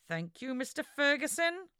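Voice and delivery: forced through clenched teeth; gritted teeth. Acted. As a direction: hold back irritation, teeth clenched. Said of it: "Lachlan forced through gritted teeth".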